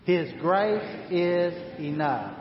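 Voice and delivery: slow His grace is enough, grace stressed; slow declaration. Shown as a demonstration. Pastor and congregation repeating the phrase slowly. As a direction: say it together, steadily. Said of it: "Say that with me".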